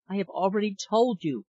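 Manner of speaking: ordinary delivery, nothing marked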